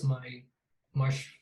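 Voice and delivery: usual speaking voice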